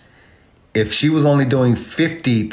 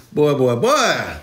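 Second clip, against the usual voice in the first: movie quote; 3 semitones higher, slower than usual. Retold or imitated. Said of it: "an example of right there".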